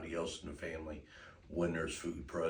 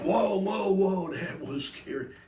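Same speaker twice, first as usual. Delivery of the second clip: much higher, louder spooked cry; startled dog voice; 11 dB louder, slower than usual. Pretend. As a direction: voice the spooked dog, high and loud. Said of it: "dogs at first gonna go".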